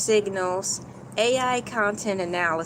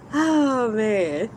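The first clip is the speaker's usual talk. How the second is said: ordinary talk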